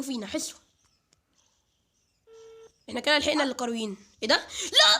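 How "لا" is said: a sudden high, loud cry of "لا!" through a laugh; startled shout. Real, not acted. Gamer yelps as a monster appears, laughing. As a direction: yelp in shock, laughing.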